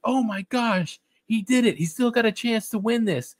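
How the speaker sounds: high-pitched mock amazement; excited commentators. Commentators as the golfer holes out, high mock excitement. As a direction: imitate an announcer thrilled by a comeback. Retold commentators cheering hole-out.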